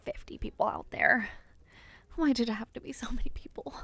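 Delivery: quick, fretful whisper; hurried whispered worry; anxious whisper. Acted. Backstage, fretting about the crowd, fast anxious whisper. Panicking alone before speech.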